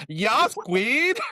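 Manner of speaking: put-on high-pitched camp German accent; camp German boy. Parent mimicking son walking in from school, camp German accent. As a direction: imitate a flamboyant little German boy. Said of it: "like, this little German boy accent".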